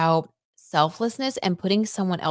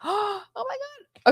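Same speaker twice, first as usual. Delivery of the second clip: stunned gasp; much slower than usual. Real, not acted. Reacting to chat news.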